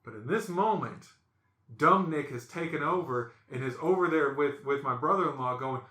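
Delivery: ordinary delivery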